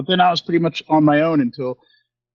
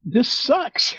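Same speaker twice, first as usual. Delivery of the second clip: fed up; 11 semitones higher than usual. Retold or imitated. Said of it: "I was just like".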